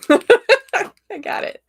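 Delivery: high burst of laughter through the words; laughing. Real, not acted. Tarot reader delighted by what she sees, laughing.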